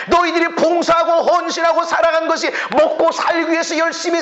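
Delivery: high, ringing preacher's proclamation; full-force preaching. Acted. Pastor rousing the congregation, high full-force preaching. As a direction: lift the room with a new beginning.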